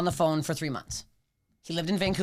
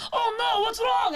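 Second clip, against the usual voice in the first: much higher mock Swedish Chef accent; slower than usual. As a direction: squeeze the pitch way up like the Swedish Chef. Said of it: "the Swedish Chef voice".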